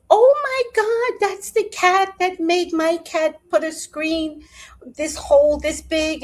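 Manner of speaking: very high, alarmed outburst; the speaker spots the bully cat, very high and loud; panicked shock. Retold or imitated. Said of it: "And I was like".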